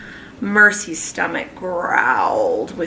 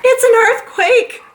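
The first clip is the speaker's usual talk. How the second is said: high, loud panicked cry; shouted; 5 dB louder than usual. Acted. Mrs Watson yelling at her husband in fright, high loud cry. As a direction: shriek it in alarm. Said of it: "shouted Missus Watson".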